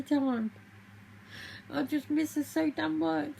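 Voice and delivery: weeping over a lost mother; real crying. Real, not acted. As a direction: sob the words out through real grief. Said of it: "And I didn't mean to cry".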